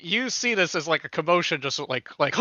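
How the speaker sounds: normal talking voice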